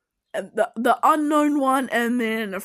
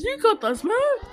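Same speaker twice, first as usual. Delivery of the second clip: higher, playful cartoon voice; sing-song it like a hype friend; playful put-on voice; 9 semitones higher than usual. Shown as a demonstration. Praising Andorra's dance, higher playful voice. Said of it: "said twice differently".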